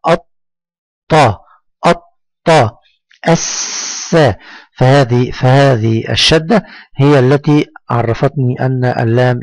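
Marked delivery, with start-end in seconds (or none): 0.1-4.2 "الطا" broken on a long doubled t, "الس" on a long s; held doubled consonant. Shown as a demonstration. Teacher demonstrates shadda, consonants held long in "الطا" and "الس". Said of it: "وكأنني أنطقه مرتين".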